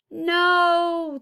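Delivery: high, loud cry of refusal; yelled. A narrator's reading. Tyree yells as the city crushes houses, high and loud. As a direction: yell at the wreckers. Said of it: "Tyree yelled".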